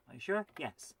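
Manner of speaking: ordinary delivery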